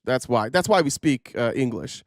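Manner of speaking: ordinary talk, nothing marked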